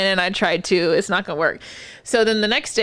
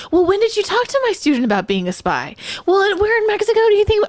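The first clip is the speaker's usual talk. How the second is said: high, squeaky mock of a patient coworker; 12 semitones higher, 4 dB louder than usual. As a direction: sing it up high like a fussy nurse. Mocking coworker questioning patient.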